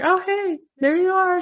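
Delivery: high, lilting mock greeting; retold cheery greeting. Hikers greeting their friend at the switchback, retold high greeting. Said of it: "we walk by and we're like".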